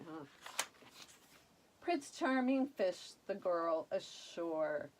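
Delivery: plain reading voice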